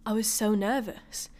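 ordinary talk

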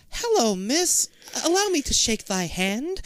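put-on high, slow old-lady voice; sweet old lady. Old nanny politely greeting the girl, sweet high voice. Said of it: "Sweet old lady".

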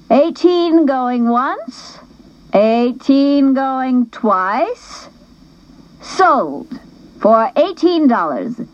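high, slow, drawn-out auctioneer chant; auctioneer call. Acted. Missus McClure closing the auction to the gym, slow and sing-song. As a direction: stretch each bid out for the room.

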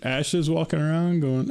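normal talking voice

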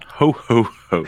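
loud hearty Santa laugh. Acted. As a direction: belt out a Santa ho ho ho. [name]'s Santa greeting.

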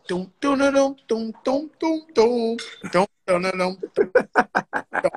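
booming "dum" beat sung out by mouth; vocalized riff, pitch jumping up then dropping; imitated music beat. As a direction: mimic the track on big speakers. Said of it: "that shit said".

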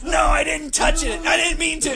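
high mock-frantic protest; panicked denial. Acting Squirrel answering Ivan's accusation, high panicked protest. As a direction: plead innocence in a high yell.

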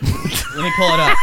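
laughing shout while pulling it up; breaking into laughter mid-sentence. Real, not acted. Host laughing as he pulls up the text, laughing.

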